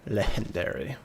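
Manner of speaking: put-on legendary with a joking Spanish accent; legendary in a playful Spanish accent; mock Spanish accent. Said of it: "Or as the Spanish would say".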